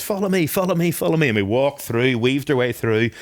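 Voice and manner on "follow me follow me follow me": high, eager beckoning; a bright, higher call to follow. Retold or imitated. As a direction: wave the group along, pitched up high. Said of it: "And then he goes".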